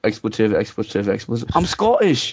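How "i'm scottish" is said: high mock-indignant insistence; offended Scotsman. Retelling a Scot correcting him, high mock indignation. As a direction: imitate someone insisting on their nationality. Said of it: "I asked him if he was British".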